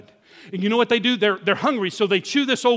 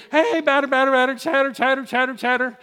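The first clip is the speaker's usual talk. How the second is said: higher, singsong Little League chant; infield baseball chatter; 4 semitones higher than usual. Retold or imitated. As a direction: call it out like a kid on the field. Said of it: "That's what you would do".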